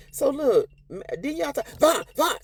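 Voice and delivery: high, pleading interjection; urgent interrupting. Retold or imitated. Mother stepping between father and son, high and urgent. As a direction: cut in to stop the fight.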